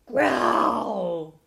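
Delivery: huge drawn-out acted roar; loud bear growl. A narrator's reading. Hungry bear roars at the animals, loud growl. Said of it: "growled the loudest growl".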